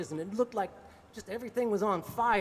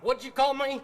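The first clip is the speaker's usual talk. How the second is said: higher and louder, squaring up; threatening challenge; 5 semitones higher, 6 dB louder than usual. Retold or imitated. Bandmate daring the narrator to repeat the insult, loud. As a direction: bristle and dare him to repeat it.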